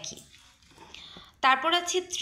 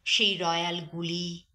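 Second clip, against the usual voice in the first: high, declaiming poetry reading with long pauses; poem recitation; 4 semitones higher than usual. Acted. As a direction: recite it like a stage poem, full of longing. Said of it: "একটা প্রিয় কবিতা আমি আবৃত্তি করব". (আবেগভরা কবিতা আবৃত্তি)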